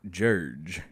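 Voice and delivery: "Judge" stretched and low with a rising end; drawled Southern Judge. Retold or imitated. Grandson imitates grandfather's drawn-out "Judge". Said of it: "my grandfather will say".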